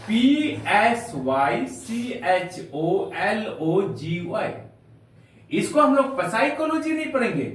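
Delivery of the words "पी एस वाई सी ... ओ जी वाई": slow spelling out. Shown as a demonstration.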